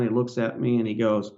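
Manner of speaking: ordinary talk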